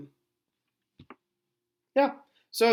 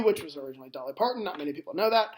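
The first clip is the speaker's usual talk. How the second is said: ordinary talk, nothing marked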